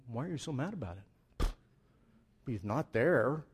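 ordinary talk

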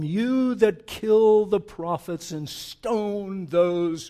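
weeping lament. Acted. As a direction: mourn over the city as if near tears. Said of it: "he begins to weep".

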